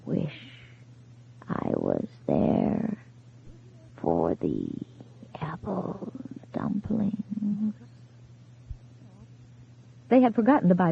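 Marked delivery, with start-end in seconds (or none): 0.0-7.7 faint whisper, slow, fading out; barely audible. A narrator's reading. Dead husband's final words to his wife, barely audible. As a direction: let the words almost vanish. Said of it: "barely audible words".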